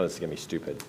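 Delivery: low, soft grumbling mutter; sullen mutter. Retold or imitated. Husband on the drive, low sullen mutter. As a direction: grumble it under your breath.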